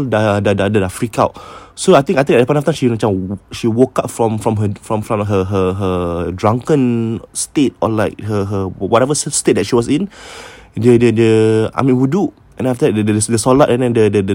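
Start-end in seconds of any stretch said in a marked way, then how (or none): none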